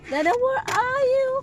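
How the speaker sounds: high, loud sing-song call to daddy. Real, not acted. Mother sing-songs to toddler, calling for daddy, high and bright.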